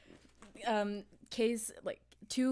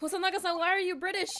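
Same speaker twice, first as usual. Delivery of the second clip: high, louder astonished voice; surprised question; 9 semitones higher, 5 dB louder than usual. Acted. Reacting to the inspector sounding British, surprised question. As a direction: call out in mock astonishment.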